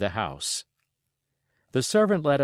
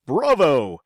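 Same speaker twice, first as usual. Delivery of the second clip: loud, high cry of delight; an exultant shout of Bravo; cried bravo; 6 semitones higher, 8 dB louder than usual. A narrator's reading. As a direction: burst out in applause. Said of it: "the squire cried".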